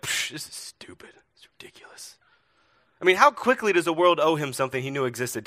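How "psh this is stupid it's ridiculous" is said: whispered mock grumbling; scoff, then complain under your breath in a whisper; disgruntled passenger. Passenger grumbling about broken internet, whispered mock complaint. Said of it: "this guy next to him was like".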